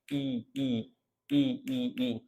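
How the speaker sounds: E repeated softly; vowel drill. Shown as a demonstration. Teacher drills the E sound. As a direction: repeat E evenly. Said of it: "E sounds like E".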